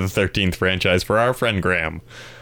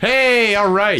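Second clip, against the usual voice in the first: excited; 11 semitones higher, much slower than usual. Real, not acted. Cheering next film pick.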